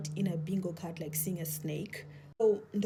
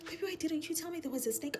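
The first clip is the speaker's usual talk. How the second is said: pleading; 9 semitones higher than usual. Retold or imitated. Wife pleading with husband.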